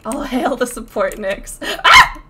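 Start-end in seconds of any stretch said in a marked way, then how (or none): 1.8-2.2 short, loud high shriek; sharp burst of a yell; startled scream. Real, not acted. Attacked during the game.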